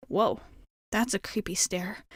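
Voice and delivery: ordinary talk, nothing marked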